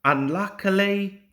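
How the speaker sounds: ordinary delivery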